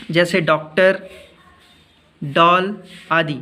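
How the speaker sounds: slow, clear डॉक्टर and डॉल with open ऑ; careful pronunciation. Shown as a demonstration. Teacher to pupils, slowly modelling डॉक्टर and डॉल. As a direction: spell out डॉक्टर, डॉल for the class. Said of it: "सही उच्चारण करना है".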